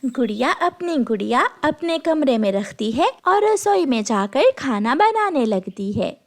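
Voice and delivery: ordinary delivery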